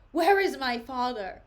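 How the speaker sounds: loud, forceful stage demand; theatrical outrage; acted angry demand. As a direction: demand it of the king, full voice. Laertes demands his father.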